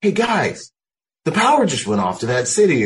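high, eager rookie voice; naive young self. Retold or imitated. Boot Marine to his seniors, high and eager. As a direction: play the clueless boot. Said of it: "So I was like".

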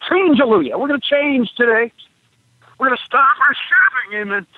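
high, singsong revival preaching at talking volume; demonstrating a gospel preacher's exhortation; preacher's shout. Shown as a demonstration. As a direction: whip up the congregation, pitch climbing. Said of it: "we ch-, we shout".

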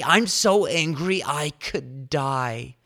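milk the tantrum, stretching so; hammed-up angry Jonah. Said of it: "Jonah's response".

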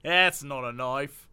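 slow, hammed-up Aussie movie-tough accent; mock Australian, drawn out slowly; bad Australian accent. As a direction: drag out broad Australian vowels. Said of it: "My apologies to Australians".

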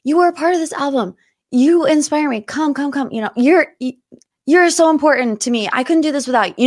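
high, sugary coaxing, lightly voiced. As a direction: coax a reluctant friend up on stage. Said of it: "And [name]'s like".

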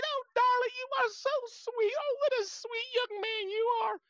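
put-on high, loud doting old lady voice; sweet old lady. Mimicking the lady thanking him, in a high doting coo. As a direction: coo like a charmed grandmother.

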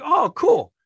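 high, loud burst of delight; excited reaction. Retold or imitated. Employee hearing a star has arrived, excited. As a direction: pitch leaps up, pleased at the news.

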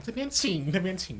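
elevator lady. Acted. Showing how to point at the moon, as a polite elevator lady. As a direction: usher guests along like an elevator lady. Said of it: "像那个电梯小姐一样".

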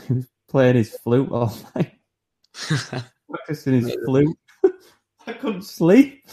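laughing through the words; giggling, rising to a squeal. Real, not acted.

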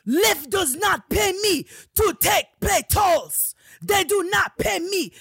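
high, very loud driver's outburst; retold screaming. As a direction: scream it out slowly, mimicking an irate driver. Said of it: "start screaming at me".

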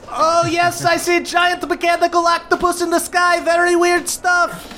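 high, bemused goblin voice; puzzled by the giant octopus; goblin captain. Acted. Ma Goblin answers Calder over receiver, high bemused voice.

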